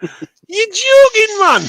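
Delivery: put-on hoog, traag en luid mislukt Geordie-accent; Geordie attempt. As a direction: roep het uit in een slecht Geordie-accent. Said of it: "That's not Geordie".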